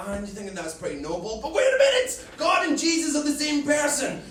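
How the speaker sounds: usual speaking voice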